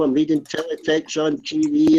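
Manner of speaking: ordinary delivery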